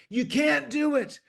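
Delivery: [name] forbidding it, pitch way up; emphatic [name]. Retold or imitated. [name] begging the producer to cut the clip, high loud insistence. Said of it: "he was emphatic".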